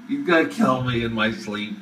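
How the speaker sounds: ordinary talk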